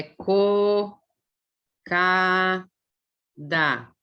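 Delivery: slow, broken into syllables. Shown as a demonstration. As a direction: read it like a child sounding out each syllable. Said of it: "ela ia ler assim". (lendo sílaba por sílaba, pausado)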